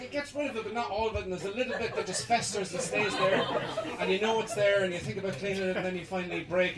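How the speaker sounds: ordinary talk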